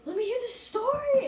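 high, loud eager request. Retold or imitated. Mom at the truck asking for the tale, high eager voice. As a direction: she can't wait to hear it. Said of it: "and she's like".

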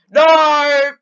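a sudden loud cry of dismay; shouted no. Real, not acted. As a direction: yell it out in shock. Game loss, shouting at screen.

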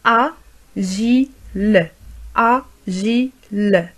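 "Agile" split slowly as a, gi, le; very slow "Agile", pause after each syllable. Shown as a demonstration. Teacher modelling for learners, syllable by syllable "Agile".

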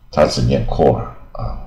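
ordinary talk

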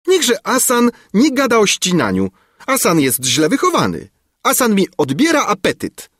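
very high, scolding princess; hot-tempered outcry. A narrator's reading. Princess scolding the minister at breakfast, hot-tempered. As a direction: scold the man in a high indignant voice. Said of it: "zawołała porywcza księżniczka".

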